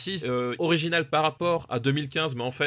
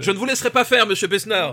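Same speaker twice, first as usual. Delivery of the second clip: mock outrage; 6 semitones higher, 7 dB louder than usual. Joue la politesse outrée, en riant.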